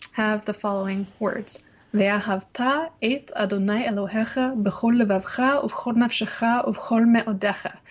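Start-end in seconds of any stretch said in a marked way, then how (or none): 1.9-7.8 plain Hebrew reading. Shown as a demonstration. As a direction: read the Hebrew verse straight, not chanted. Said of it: "you can read it the way I just did".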